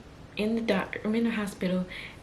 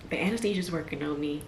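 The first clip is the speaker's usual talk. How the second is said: normal talking voice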